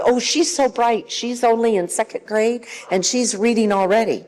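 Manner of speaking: high, gushing proud parent; boasting parent. Retold or imitated. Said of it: "because they'll tell you".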